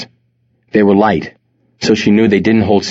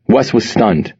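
ordinary delivery

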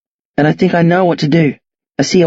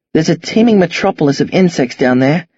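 ordinary delivery, nothing marked

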